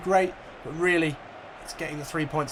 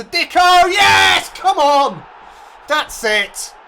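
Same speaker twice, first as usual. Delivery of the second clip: loud, much higher shouts of joy; goal celebration; 14 dB louder, much slower than usual. Real, not acted. Gamer celebrating Dicko's goal, ecstatic shouting. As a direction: roar as the ball goes in. His team scores.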